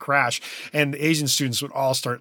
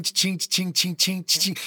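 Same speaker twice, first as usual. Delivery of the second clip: light higher ching ching like a cymbal; cymbal sound imitation; 5 semitones higher, slower than usual. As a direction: mimic a ride cymbal with ching. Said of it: "to make the sound of".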